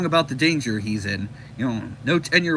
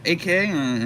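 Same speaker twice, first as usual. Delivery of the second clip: plain reading voice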